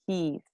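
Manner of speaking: "Keys" softly, with a long ee; correct pronunciation. Shown as a demonstration. Teacher repeating corrected word, correct pronunciation. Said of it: "not case".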